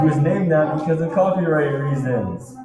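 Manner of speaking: loud. Acted. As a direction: call it out brightly. Narrator reassures loudly.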